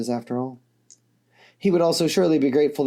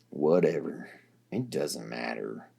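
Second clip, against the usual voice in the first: much lower and softer, trailing off; dismissive Dirk; 12 semitones lower, 8 dB quieter, slower than usual. A narrator's reading. Dirk, shrugging at Nepeta, low and dismissive.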